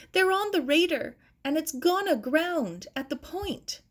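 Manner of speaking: high, urgent report of the danger; alarmed. Acted. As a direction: blurt it out, frightened. Maggie reports boat aground.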